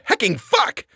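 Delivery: high, yelped swear; a startled yelp of a curse. Retold or imitated. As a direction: blurt it out in fright. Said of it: "something along the lines of".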